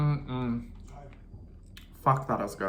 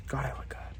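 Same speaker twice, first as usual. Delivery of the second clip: self-admiring whisper; 2 semitones higher, 8 dB quieter than usual. Real, not acted. Admiring herself in mirror.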